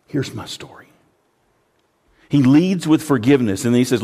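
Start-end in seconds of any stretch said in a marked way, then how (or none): 0.1-0.9 whispered. Real, not acted. Whispered aside.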